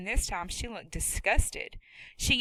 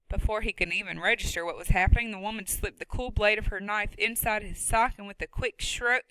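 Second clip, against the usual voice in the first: plain reading voice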